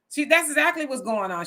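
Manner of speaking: usual speaking voice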